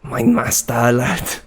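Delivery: mock German accent attempt; trying on a German accent, word by word. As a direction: test out a put-on German accent. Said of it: "trying to say in this accent".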